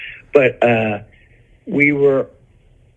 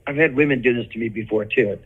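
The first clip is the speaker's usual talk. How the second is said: normal talking voice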